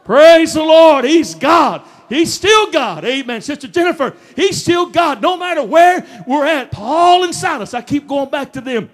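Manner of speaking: high, exultant sermon shout; full-force preaching. Acted. Preacher praising God before church, full-force preaching. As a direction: proclaim it to the whole church.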